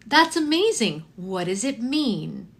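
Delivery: imitating a high, slow talking-to-children voice; great with kids. Friend praising five-year-old's Spanish song, kid-friendly gushing. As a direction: gush slowly, as to a small child. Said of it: "being great with kids".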